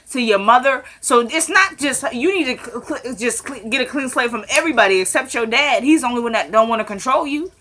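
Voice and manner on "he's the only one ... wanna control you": normal talking voice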